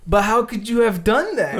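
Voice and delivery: loud, high bafflement; confused and suspicious; mock baffled scammer; flustered scammer. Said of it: "They get so flustered".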